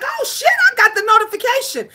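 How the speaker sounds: high, fast, surprised blurt; shocked. Retold or imitated. Retelling getting the notification, high fast surprised. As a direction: replay the shock of seeing it. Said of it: "I was shocked".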